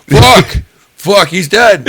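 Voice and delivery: high, loud mock-alarmed outburst; retold panic. Storyteller reliving his seatmate's overdose, mock panicked outburst. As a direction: blurt it out in comic panic. Acts out seeing friend overdose.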